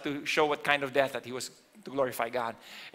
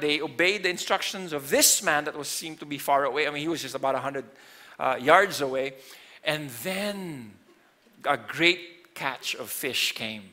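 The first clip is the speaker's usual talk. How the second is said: ordinary delivery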